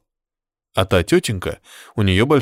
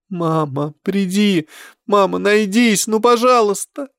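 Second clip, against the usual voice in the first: pleading call; 10 semitones higher, slower than usual. Acted. Lost child calling mother.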